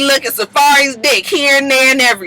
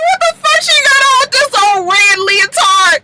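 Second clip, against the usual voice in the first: mock outrage yell; 13 semitones higher than usual. Real, not acted. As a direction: holler in disbelief at the outfit. Host mocking outfit, yelling.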